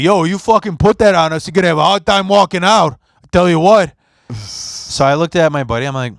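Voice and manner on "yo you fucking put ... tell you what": higher, louder, fast tough-guy threat; mock tough guy. Goon at the hookah bar threatening the teller, higher and louder. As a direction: puff up and warn him off, talking fast.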